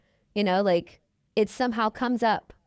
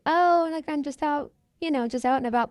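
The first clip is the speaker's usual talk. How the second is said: breezy cover-up; 7 semitones higher than usual. Retold or imitated. Casual deflecting reply to mother.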